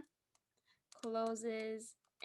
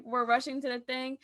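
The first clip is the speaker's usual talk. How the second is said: ordinary delivery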